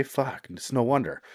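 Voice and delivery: ordinary talk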